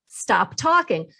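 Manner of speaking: "Stop talking." spelled out very slowly, "talking" leaned on and falling; slow right down and hammer each word of "Stop talking."; emphatic slow advice. Shown as a demonstration. The coach stresses the key rule to the listener, slow and firm. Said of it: "Now, here's the key".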